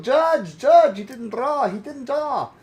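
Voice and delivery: high, loud whiny plea to the judge; mock a player crying to the judge. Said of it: "The people go".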